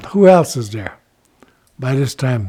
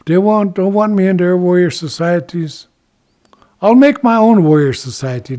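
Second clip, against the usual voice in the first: raised, loud defiant declaration; defiant vow; 5 semitones higher, 7 dB louder than usual. Acted. Rejected Ichiko vows his own warrior society, loudly defiant.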